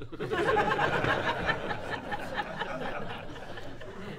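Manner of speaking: long wheezing, breathy laugh. Real, not acted. Recalling the jug in a hundred halves, breathy real laugh.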